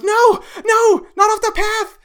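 high, loud panicked pleading; panicked cry. Acted. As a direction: cry out in alarm to stop him. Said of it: "And Belgo goes".

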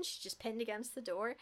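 ordinary talk, nothing marked

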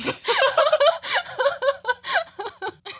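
big high burst of laughter; loud high laugh. Real, not acted. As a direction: crack up loudly. Group laughing at joke.

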